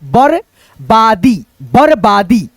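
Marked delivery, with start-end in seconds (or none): 0.1-1.4 बरबादी very slow, syllable by syllable; split syllables. Shown as a demonstration. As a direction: break बरबादी into parts slowly. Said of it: "पढ़ेंगे इसको र". (बरबादी को अलग-अलग अक्षरों में धीरे)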